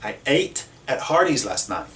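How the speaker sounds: ordinary talk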